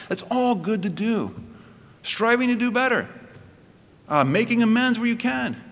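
high, fervent preaching voice. Real, not acted. Pastor urging the congregation to strive, fervent preaching. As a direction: preach it out with rising fervour.

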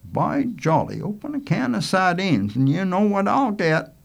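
a touch of folksy indignation; grumbling Manley. Acted. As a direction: complain heartily about being short-changed. Manley complains about sardines.

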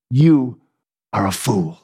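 low, heavy final judgment; a touch of a grave prophet; stern verdict. Acted. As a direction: drop the voice and condemn him. Daniel's verdict on the king.

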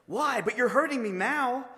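high, aggrieved cry; pained ghost protest. Acted. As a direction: complain in alarm at being hurt. Ghost protests being hurt.

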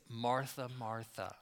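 soft, low, loving address; tender, loving. Retold or imitated. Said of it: "he says it so lovingly".

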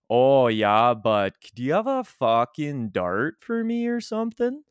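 drawn-out Ontario vowels, swooping high; Ontario accent. Shown as a demonstration. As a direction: let the Ontario accent roll over hills and valleys. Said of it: "it's just much more drawn out".

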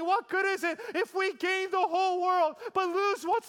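high, loud, driving sermon climax; preaching at full force. Acted. Pastor urges the congregation to choose God, fervent preaching. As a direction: build the rhetorical questions to a peak.

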